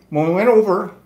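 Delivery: ordinary delivery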